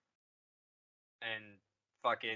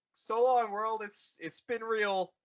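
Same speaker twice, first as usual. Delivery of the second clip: higher, louder hammed-up farewell; mock dramatic farewell; 8 semitones higher, 5 dB louder than usual. Leia bidding the world farewell, hammed-up farewell. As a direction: ham up a grand goodbye. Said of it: "she's like".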